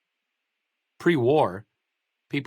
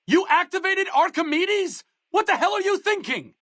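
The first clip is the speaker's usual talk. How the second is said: furious alarm. Acted. Companion horrified that the player fired the laser, furious alarm. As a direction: shout it in horrified anger.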